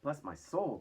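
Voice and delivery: astonished loud exclamation; cried. A narrator's reading. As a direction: blurt it in amazement. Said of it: "cried the head of the fire department".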